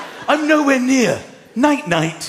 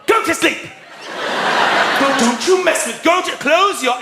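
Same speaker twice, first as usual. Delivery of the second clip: angry parent; 5 semitones higher than usual. Retold or imitated. Dad acts out ordering his children to bed, angry. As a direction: bark at the kids to sleep. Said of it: "it just carries on".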